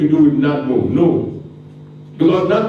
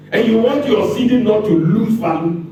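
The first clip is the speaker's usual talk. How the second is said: ordinary talk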